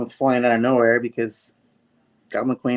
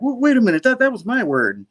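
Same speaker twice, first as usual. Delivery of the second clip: high, loud indignant interruption; mock protest; 12 semitones higher, 5 dB louder, faster than usual. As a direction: act put out, pitch jumping up. Acted protest over stolen word.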